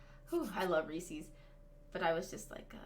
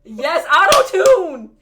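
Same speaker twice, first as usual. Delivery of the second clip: excited shout; 10 semitones higher, 20 dB louder, much slower than usual. Real, not acted. Cheering the auto-tune effect.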